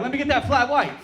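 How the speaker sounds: high, loud wired customer order; hammed-up amped coffee order; mock order. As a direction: order it all jacked up. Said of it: "You're like".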